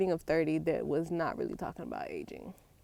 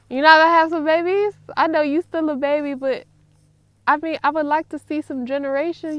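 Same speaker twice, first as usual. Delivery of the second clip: loud, higher, fast mock outrage; mock scolding; 7 semitones higher, 10 dB louder than usual. Real, not acted. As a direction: tell him off playfully. Scolding her son about babies.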